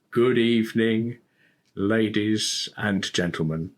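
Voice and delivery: slow, deliberate stage greeting; very slowly. Retold or imitated. Comedian re-enacts his cautious opening to a New York crowd, very slowly. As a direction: draw out each word for the audience. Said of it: "I start speaking very slowly".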